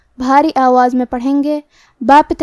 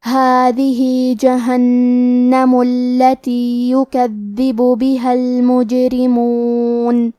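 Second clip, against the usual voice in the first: slow, melodic tajweed chant; Quran recitation; 2 semitones lower, slower than usual. Shown as a demonstration. Teacher reciting the verse to students, slow melodic chant. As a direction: draw each vowel out in measured recitation.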